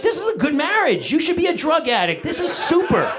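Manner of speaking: high, gleeful mock praise; mock delighted. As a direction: imitate yourself thrilled, laughing. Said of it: "I was like".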